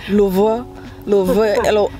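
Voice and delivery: ordinary talk, nothing marked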